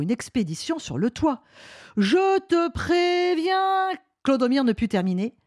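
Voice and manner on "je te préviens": high, stretched-out warning cry; old man's slow, rising warning; drawn-out warning. Acted. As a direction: cry out a long, high threat as you slip. Old man threatens while slipping.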